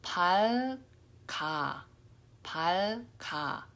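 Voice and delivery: 밝아 with a clear L and the G carried to 아, slowly; 밝아, L sound then G moving onto the vowel; slow 밝아, L and G linked into the next syllable. Shown as a demonstration. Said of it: "with the tongue making that L sound".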